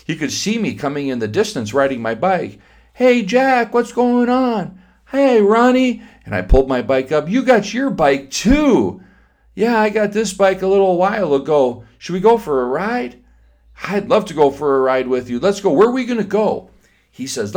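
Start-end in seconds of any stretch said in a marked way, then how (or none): 2.9-6.0 put-on high, loud child greetings; kids calling out. 7.3-16.7 put-on higher eager child voices; excited kid chatter. Two boys chatting.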